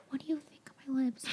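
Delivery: anxious whisper to a friend. Retold or imitated. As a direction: breathe the question, almost no voice. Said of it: "going up to everybody whispering".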